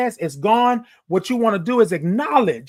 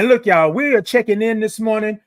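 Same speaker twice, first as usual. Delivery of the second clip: normal talking voice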